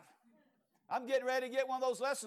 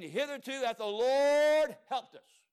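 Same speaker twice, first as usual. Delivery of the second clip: preaching climax; 4 semitones higher, 5 dB louder than usual. Acted. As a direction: lean into the crescendo.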